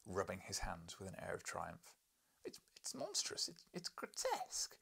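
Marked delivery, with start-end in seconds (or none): 2.5-4.8 much higher, stammering shock. Acted. Narrator aghast at the professor's drawing. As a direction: stumble over it, aghast.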